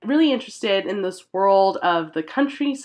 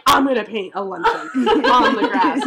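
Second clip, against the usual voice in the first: laughing mock boast; 2 semitones higher than usual. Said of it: "He's like".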